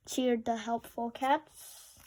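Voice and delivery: ordinary delivery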